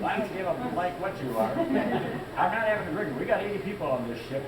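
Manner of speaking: high, emphatic mock protest; imitated heated argument; retold indignant refusal. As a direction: act out standing up to the boss. Retold quarrel, sailor refuses boss.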